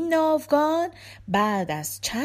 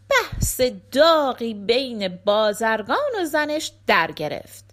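ordinary delivery, nothing marked

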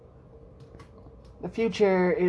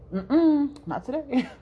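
stubborn leg refusing; 7 semitones higher than usual. Pretend. Talking about her weak leg, a playful higher mock refusal. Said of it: "that leg is just like".

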